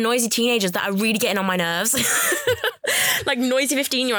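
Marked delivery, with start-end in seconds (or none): 2.0-3.2 sudden high burst of laughter; a real laugh breaking out, pitched up. Real, not acted. Laughing at her own rant.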